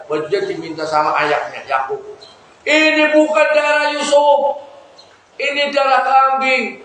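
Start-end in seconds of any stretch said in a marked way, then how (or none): 2.6-6.8 high, stern father's protest; acted accusation. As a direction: confront the sons with their lie. Father challenges his lying sons.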